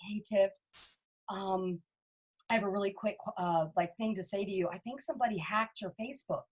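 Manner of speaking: a mock nervous warning, voice dropped low and hesitant; hesitant worried friend.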